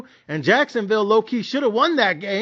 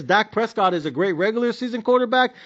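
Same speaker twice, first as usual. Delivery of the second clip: ordinary talk, nothing marked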